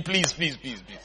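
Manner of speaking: low, hushed mock begging. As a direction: beg under your breath, trailing away. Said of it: "we started begging him".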